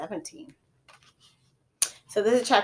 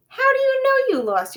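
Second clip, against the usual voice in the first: surprised mother; 9 dB louder than usual. A narrator's reading.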